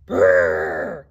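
loud rolled lip-buzz trumpet; elephant's trumpeting. A narrator's reading. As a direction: blast out a mock elephant noise. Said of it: "He sucked in the air".

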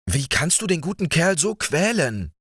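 high, tearful-sounding plea; plaintive lament. A narrator's reading. Little sister laments to Georg, high and plaintive. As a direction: plead for the dog, near tears. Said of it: "klagte Edith".

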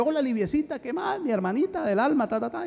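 high, lilting mock-sweet hello; affectionate greeting. Brother greeting his sister by phone, affectionate.

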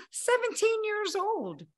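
high, slow disbelief at her age; disbelieving emphasis. Real, not acted. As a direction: stress seventeen high and slow, as if it can't be true.

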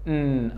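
"N" hummed softly as "nn"; letter N sound. Shown as a demonstration. Teacher demonstrating N from the nose, soft "nn". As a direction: sound "N" through the nose, quietly. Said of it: "It is pronounced like".

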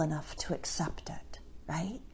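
whispered, intimate aside. Real, not acted. Preacher to listeners about accepting grace, whispered. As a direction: lean in and whisper it.

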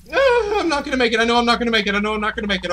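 high, loud panicky wail; panicked. Real, not acted. Gamer panicking at the dark alone, high and frightened. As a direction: fear takes over, voice climbs up.

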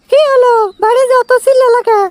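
high put-on woman's voice, asking; wife's voice. Wife asking why there's shouting at home, in a high woman's voice. Man voicing his wife.

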